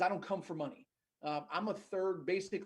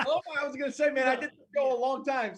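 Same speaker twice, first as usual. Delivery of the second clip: laughing relief; 8 semitones higher, 8 dB louder than usual. Real, not acted. Teased guest reacts, laughing.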